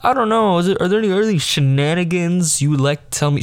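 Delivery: high, teasing push on shenanigans; playful prying imitation; mock sing-song teacher. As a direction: lean on "shenanigans" with a knowing lilt. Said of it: "her emphasizing the word shenanigans".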